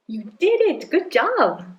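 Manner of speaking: put-on high-pitched cheer for a child; delighted, high praise; excited praise. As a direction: celebrate their success, voice swooping up high. Mother praises child in skit.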